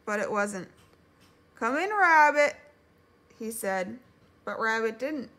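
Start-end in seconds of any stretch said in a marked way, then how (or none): 1.6-2.6 loud, higher-pitched welcoming call. A narrator's reading. Pooh inviting the knocker in, calling out. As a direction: project it through a closed door. Pooh calls through the door.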